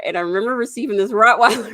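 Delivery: ordinary talk, nothing marked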